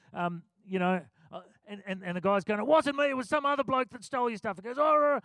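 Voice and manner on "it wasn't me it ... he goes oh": higher, louder protest of innocence; rising argument. Retold or imitated. Man at the soup kitchen protesting, raised voice. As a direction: bloke shouting his denial back. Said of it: "the voices started rising".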